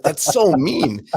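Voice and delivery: slow, high, drawn-out mock whine; playful imitation of a hurt daughter; mock whiny complaint. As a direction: stretch it like a sulky ten-year-old. Retold daughter complaining to father.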